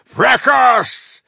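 high, trumpeting shout of triumph; wild, resounding cry. A narrator's reading. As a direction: trumpet it out wildly in victory. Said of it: "trumpeted a wild, resounding".

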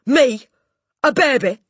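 high, loud, outraged disbelief; shouting Trunchbull. A narrator's reading. Headmistress affronted by a pupil, outraged shout. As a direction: shout each question in outrage. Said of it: "shouted the Trunchbull".

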